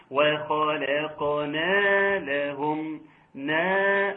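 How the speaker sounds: وخلقنا لهم with the final نا held two beats; natural two-beat madd. Shown as a demonstration. Teacher demonstrates the natural elongation on نا. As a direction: stretch نا in وخلقنا for two counts. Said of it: "الحفاظ على المد الطبيعي بمقدار حركتين".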